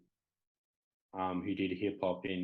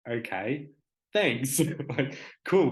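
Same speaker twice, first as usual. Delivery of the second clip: hesitant okay, then a louder, higher thanks; puzzled but playing along, pitch rising; confused thanks; 5 semitones higher, 7 dB louder, slower than usual. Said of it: "confused at the same time".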